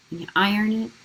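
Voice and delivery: ordinary talk, nothing marked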